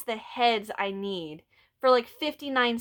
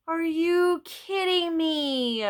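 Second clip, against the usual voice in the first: disbelief; 8 semitones higher, much slower than usual. Retold or imitated. Opening the disappointing package.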